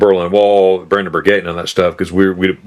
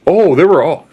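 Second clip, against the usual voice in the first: mocking quote; 9 semitones higher, 4 dB louder than usual. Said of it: "that's what people think".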